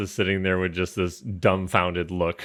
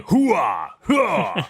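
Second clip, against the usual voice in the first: high grunts of mock effort; hammed-up grunting; 9 semitones higher than usual. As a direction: strain out a show-off grunt. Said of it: "does some grunting".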